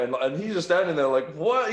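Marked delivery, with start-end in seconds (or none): 1.3-1.7 baffled what. Retold or imitated. Guard confused at her outburst.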